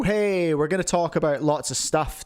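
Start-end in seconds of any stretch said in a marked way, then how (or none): none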